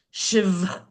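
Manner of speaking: Shiv with the final v drawn out and voiced; enunciated final V. Shown as a demonstration. Teacher showing how the A works, final V of Shiv emphasized. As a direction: hold and stress the V ending Shiv. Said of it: "asks you to enunciate the V".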